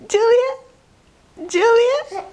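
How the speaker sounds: high sing-song call; playful sing-song calling. Real, not acted. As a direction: call her name up high.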